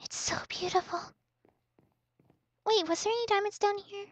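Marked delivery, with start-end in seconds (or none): 0.0-1.0 hushed, unvoiced wonder; soft whispered beautiful; awed whisper. Real, not acted. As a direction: whisper it in awe.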